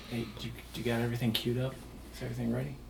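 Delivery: soft, quick secret whisper. Acted. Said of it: "kind of whispering and says".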